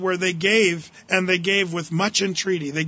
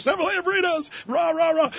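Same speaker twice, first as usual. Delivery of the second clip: much higher, shouted pep chant; hammed-up cheerleader yell; mock cheerleader cheer; 10 semitones higher than usual. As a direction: cheer it like a pom-pom squad. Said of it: "there were cheerleaders".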